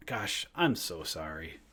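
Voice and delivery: low, soft, slow apology as Biden; subdued, slow Biden voice; Joe Biden. Acted. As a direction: mumble a sheepish apology in a Biden impression. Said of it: "those are my impressions for today".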